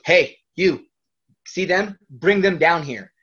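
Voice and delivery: loud, commanding call; wrestler giving orders. Retold or imitated. Wrestler telling someone to bring the family down, loud command. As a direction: imitate him summoning someone from afar.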